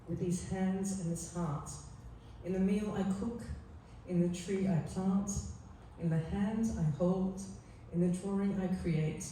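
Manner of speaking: quiet heartfelt recitation; tender poem reading. Acted. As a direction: gently finish the love poem, each line savoured. Resumes the poem after tears.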